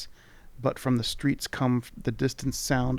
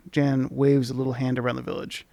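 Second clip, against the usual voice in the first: ordinary talk, nothing marked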